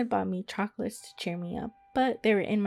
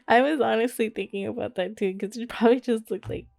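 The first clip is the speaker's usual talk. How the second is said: laughing. Real, not acted. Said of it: "I'm just still laughing".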